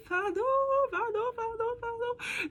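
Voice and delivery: high, squeaky laughing voice; giggling apology. Real, not acted. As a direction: apologize through a helpless giggle fit. Giggle fit, apologizing mid-story.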